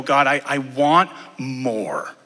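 slow, halting plea; longing prayer. Retold or imitated. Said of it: "you go".

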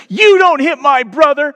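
put-on high, loud kid shout full of attitude; big brother defending his sibling, shouting high; five-year-old attitude. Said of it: "with all the attitude of a five-year-old".

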